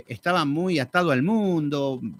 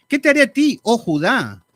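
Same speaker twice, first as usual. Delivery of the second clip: indignant God; 4 semitones higher, 6 dB louder than usual. Acted.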